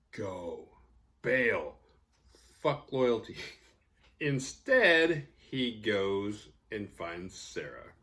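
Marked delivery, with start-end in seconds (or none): none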